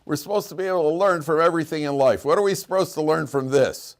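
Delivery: high-pitched mock yell from mid-fight; acted-out yell. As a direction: call out like a guy yelling in a brawl. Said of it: "one of the guys yells".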